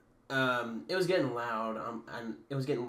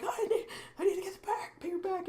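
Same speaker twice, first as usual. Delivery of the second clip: squeaky, very high imitation of panic; mock panic. Scared boy wanting his paper back, mock panic. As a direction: mimic a frantic boy way up high.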